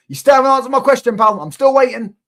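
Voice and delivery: high, loud goading jeer; loud mocking demand for an answer; taunting shout. Real, not acted. Taunting rival fan over trophies.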